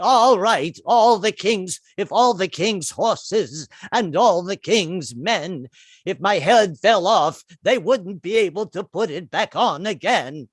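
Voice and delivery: put-on slow, wavering Hepburn-like old lady; slow, wobbly grande dame voice; Pelosi as Kate Hepburn. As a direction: drawl it out like an aging Hepburn. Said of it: "She sounds a bit like Kate Hepburn".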